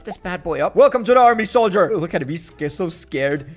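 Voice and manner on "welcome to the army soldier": loud, raised mock-military welcome; hammed-up army welcome; mock army officer. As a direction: greet a recruit like a sergeant. Greets new pet as a recruit.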